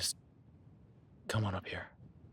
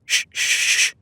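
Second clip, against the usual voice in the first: sharp loud shushing; 13 dB louder, faster than usual. Acted. Hushing sharply.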